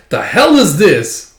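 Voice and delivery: loud, slow, high exclamation; bewildered outburst. Retold or imitated. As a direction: react to an unexpected trap. Pursuers hit sticky trap.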